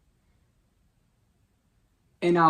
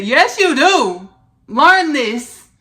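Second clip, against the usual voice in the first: loud, high, drawn-out mock correction; emphatic retort; 8 semitones higher, 13 dB louder, much slower than usual. Ancestor correcting her about having a teacher, emphatic retort. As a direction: exclaim it big, stretching the words.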